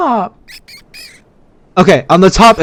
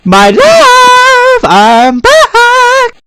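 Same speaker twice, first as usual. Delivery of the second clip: very high, drawn-out singsong cry; mock-romantic greeting; 4 dB louder, much slower than usual. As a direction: call out to a sweetheart, hammed-up. Greets a beloved character on return.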